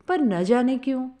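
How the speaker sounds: usual speaking voice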